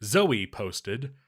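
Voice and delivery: ordinary talk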